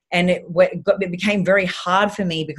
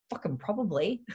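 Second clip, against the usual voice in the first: much softer, under-the-breath concession; muttered admission; 11 dB quieter than usual. Retold or imitated. Patient conceding to the physio, muttered. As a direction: grudgingly admit it to yourself. Admitting fear, muttered aside.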